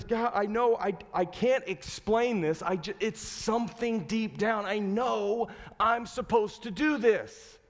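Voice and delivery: high, strained pleading with a wife; acted exasperated conviction, pitched high; frustrated insistence. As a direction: insist on it, struggling to explain. Husband insists during a fight.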